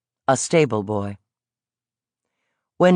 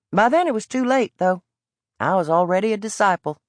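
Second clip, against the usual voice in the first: put-on evasive Southern boy; 2 semitones higher than usual. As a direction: tease without giving anything away. Boy boasts of reading.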